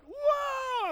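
high yelped whoa as the plane spins; startled whoa. Retold or imitated. Pilot yelping as the plane spins, startled. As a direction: yelp it out in sudden panic.

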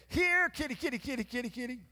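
high sing-song kitty call; calling a cat. Retold or imitated. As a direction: coax the cat in from the yard. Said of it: "I would never do that".

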